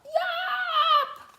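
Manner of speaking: very high, loud single yawp; shouted yawp. A narrator's reading. The lad shouts the deciding yawp from the tower. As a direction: blast one tiny yelp skyward. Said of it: "shouted out".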